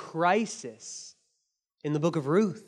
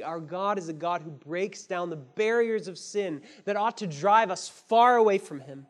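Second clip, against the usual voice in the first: ordinary talk, nothing marked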